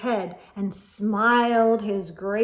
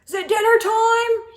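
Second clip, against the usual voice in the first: high, loud bellow; 12 semitones higher, 7 dB louder than usual. A narrator's reading. Sheep bellows down the hillside, loud call. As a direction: bellow the question. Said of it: "he bellowed".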